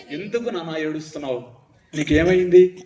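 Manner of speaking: put-on low, gentle caring question to a weeping child; tender angel voice. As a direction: soothe her like a kind messenger. Angel asks weeping Hagar.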